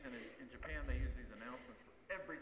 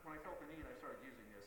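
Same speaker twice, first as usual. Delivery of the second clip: ordinary talk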